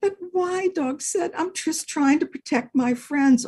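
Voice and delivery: high, eager dog voice; earnest little dog. Acted. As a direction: explain earnestly like a small dog. Dog voice again.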